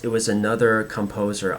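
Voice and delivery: normal talking voice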